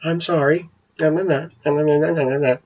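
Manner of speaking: imitating a meek, high-pitched apology; mousy voice. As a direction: squeak it out like a cowed mother. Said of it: "my mother in her mousy voice".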